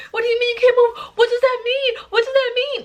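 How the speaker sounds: very high, squealing imitation of alarmed friends; mock panic. Friends not understanding her crisis, high mock panic.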